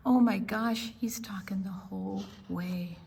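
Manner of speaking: ordinary delivery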